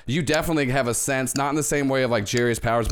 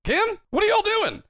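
put-on chirpy old-fashioned bellhop; 11 semitones higher than usual. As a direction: squawk it out like a shocked bellhop. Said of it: "a very familiar voice of a bellhop".

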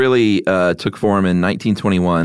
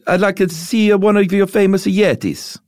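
high-pitched mock Italian accent; bad Italian accent; 12 semitones higher than usual. Podcaster quoting Alexander the Great, bad Italian accent. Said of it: "What did ancient Romans sound like? If not Italians".

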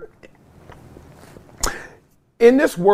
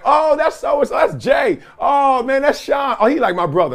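put-on excited girl's introduction; 7 semitones higher than usual. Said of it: "then she'd be like".

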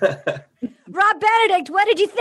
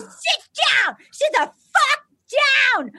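mock yell; 11 semitones higher than usual. Acting Ed yelling at Jason.

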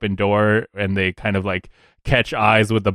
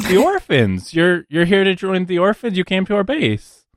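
higher, matter-of-fact recruiter voice; higher-pitched recruiter, mildly taken aback; puzzled recruiter; 10 semitones higher than usual. Acted. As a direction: explain the obvious in a raised voice.

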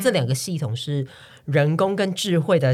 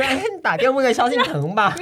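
joking quip; 8 semitones higher, 4 dB louder than usual. Retold or imitated. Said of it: "我说".

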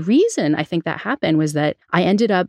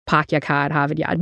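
dropped R's, New Bedford style; Boston-area accent with R's gone. Shown as a demonstration. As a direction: drop every R like a New Bedford local. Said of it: "it was a New Bedford accent".

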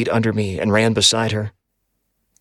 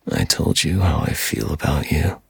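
dark whisper behind you; 5 semitones lower than usual. A narrator's reading. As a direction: growl it from the shadows. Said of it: "deep, distorted, and gravelly".

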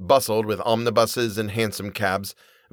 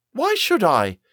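higher-pitched defiant retort; defiant sister; 12 semitones higher, slower than usual. A narrator's reading. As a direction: challenge it like a stubborn girl. Sister defiantly argues back.

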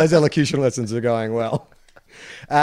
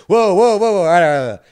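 loud, higher-pitched string of whoas; mock alarm; 6 semitones higher, 7 dB louder, faster than usual. Co-host reacting to the American's bogan phrases, mock alarm. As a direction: rein him in with exaggerated loud protest.

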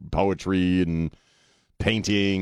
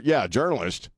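ordinary talk

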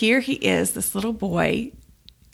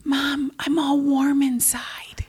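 put-on slow, awed child's whisper; hushed whisper; 6 semitones higher, slower than usual. Boy after prayer, retold.

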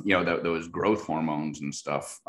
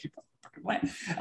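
eye-rolling grumble; 8 dB quieter, faster than usual. Retold or imitated. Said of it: "rolling her eyes at me".

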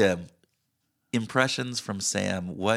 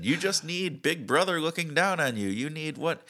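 high-pitched, sarcastic certainty; mock-earnest agreement; 7 semitones higher than usual. Co-host mocking the more-information fallacy, high sarcastic agreement. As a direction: parody a naive believer, pitched well up. Playing along with naive mock argument.

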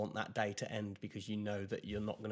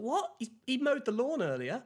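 much higher, louder mock outrage; incredulous mum; 14 semitones higher, 6 dB louder than usual. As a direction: mum cutting down dad's no-energy claim, squeaky high with disbelief. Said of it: "She's like".

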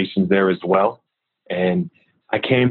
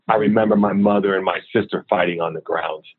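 ordinary delivery

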